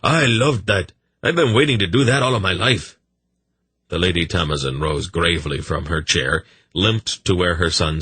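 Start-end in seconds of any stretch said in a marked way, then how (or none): 0.0-3.0 a high, laughing burst of pure glee; whooping delight. A narrator's reading. Young king to his mother after the general leaves, delighted. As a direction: let the joy spill over after holding it in. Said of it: "whooping with delight".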